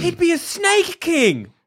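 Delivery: high, drawn-out exclamation; delighted cry, stretching the words; excited outburst. Real, not acted. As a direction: crow it out high and slow. Gleeful outburst about imagined Harry.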